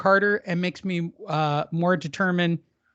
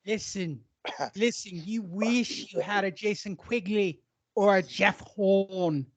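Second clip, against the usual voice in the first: mock English accent; 3 dB quieter than usual. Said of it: "Wait, let me do it".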